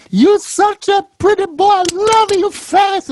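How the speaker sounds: put-on very high, cooing old Italian grandmother; grandma voice. Grandmother cooing over her little grandson, very high voice. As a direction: coo it like a doting grandma pinching a cheek. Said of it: "She'd go".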